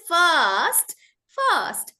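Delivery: "first" drawn out with a silent R; British "first". Shown as a demonstration. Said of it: "they don't roll their R's".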